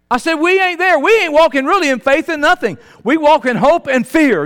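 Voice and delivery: loud, high, driving sermon voice; fiery preaching. Acted. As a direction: preach it out to the congregation. Preacher at full force.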